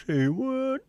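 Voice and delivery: high, squeaky incredulous yelp; high put-on astonishment; mock disbelief. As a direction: squeal it in fake shock.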